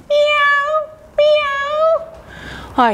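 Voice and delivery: high squeaky cat meows; kitty meows. Pretend. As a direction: meow like a happy kitten. Happy cat pose meows.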